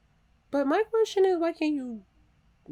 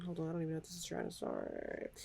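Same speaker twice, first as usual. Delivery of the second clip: ordinary talk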